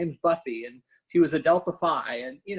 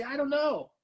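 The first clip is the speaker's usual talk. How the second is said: higher, playful shrugging reply; mock helpless answer; 9 semitones higher than usual. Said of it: "And they say".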